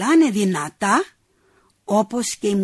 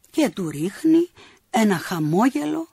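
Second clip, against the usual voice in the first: plain reading voice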